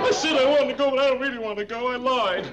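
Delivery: high pleading whine. Acted. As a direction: whine like a begging child. Begging not to be sent away.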